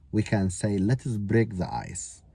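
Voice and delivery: ordinary talk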